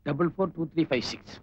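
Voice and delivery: low, soft echo of digits; murmured repeat. Acted. Man repeats the officer's phone number as he dials, quiet murmur. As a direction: say it under your breath while dialling.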